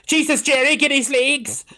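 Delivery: high, urgent order to a colleague; urgent alarm. Retold or imitated. Nurse urging Jerry to grab the fainting patient's legs, high and urgent. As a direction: snap out an order in a panic.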